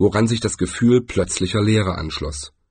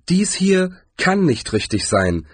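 higher-pitched woman's voice; lightly voiced woman, pitched up; woman character; 9 semitones higher than usual. A narrator's reading. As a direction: point out the mistake in a high female character voice. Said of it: "sagte sie".

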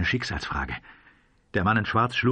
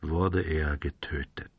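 low, slow and hushed; soft, lifeless delivery; toneless; 5 semitones lower, 5 dB quieter, slower than usual. A narrator's reading. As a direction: say it tonelessly, drained. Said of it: "sagte der Revolvermann tonlos".

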